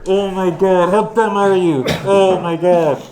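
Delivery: loud, high disbelief; exasperated outburst. Real, not acted. Player appalled at teammate's foolish move, loud exasperation. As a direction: berate a friend in appalled amazement.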